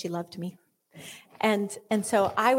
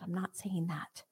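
unvoiced whispered aside; 4 semitones lower, 8 dB quieter than usual. Real, not acted. Preacher whispering an aside to the church.

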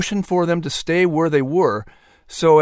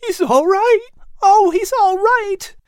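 much higher, louder joyful relief; happy tears; 5 dB louder than usual. A narrator's reading. Boy hearing his dog is safe, tearful joy. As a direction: choke out the relief. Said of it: "lip trembled happily through tears".

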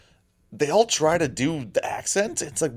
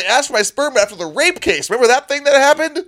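much higher, panicked protesting voice; hammed-up frantic character bursting in; frantic denial; 10 semitones higher, 4 dB louder than usual. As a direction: blurt it out like a guy desperate to clear his name. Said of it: "pounds in, and goes".

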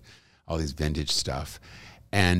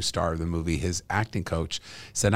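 ordinary talk, nothing marked

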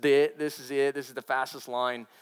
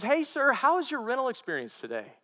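chirpy clerk; 10 semitones higher than usual. Retold or imitated. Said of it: "He says".

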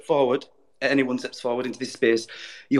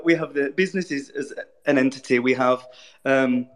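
normal talking voice

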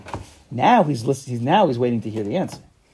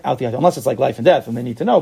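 ordinary delivery

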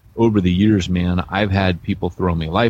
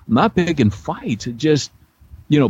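mock defensive protest; 7 semitones higher than usual. Retold protest to wife.

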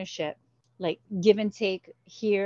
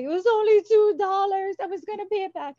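put-on high, loud, tearful plea from a grounded kid; crying plea; 13 semitones higher, 7 dB louder than usual. Grounded child cries to her dad, high and loud. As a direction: wail it like a child begging not to be punished. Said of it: "I'm crying like".

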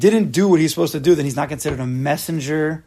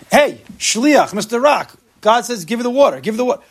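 higher, louder mock command; mock scolding shout; 6 semitones higher, 4 dB louder than usual. Telling off the rock as God's messenger, mock commanding shout. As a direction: call out like an impatient boss.

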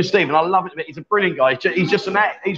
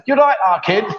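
higher, botched Scouse that slips into Brummie; bad Scouse accent; 4 semitones higher than usual. Shown as a demonstration. Host showing off his Scouse impression, badly faked Scouse accent. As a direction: try a comically poor Scouse accent. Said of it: "You wanna hear me Scouse accent?".

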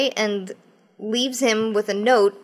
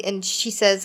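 usual speaking voice

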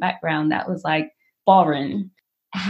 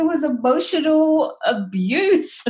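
retorting to mom; 9 semitones higher than usual. Retold or imitated. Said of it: "I was like".

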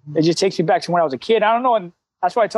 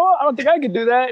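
higher-pitched buddy voice, lightly imitated; retold disbelief; 7 semitones higher than usual. Buddy reacting to the no-cussing coach, retold disbelief. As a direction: imitate an impressed friend, pitch raised. Buddy doubts he could manage it.

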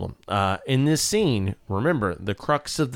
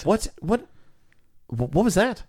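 high, incredulous what; disbelieving director reaction; baffled; 8 semitones higher than usual. Real, not acted. Director baffled by the actor's take.

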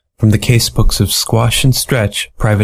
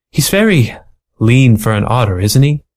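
a client smitten with the otter; admiring mink. Acted.

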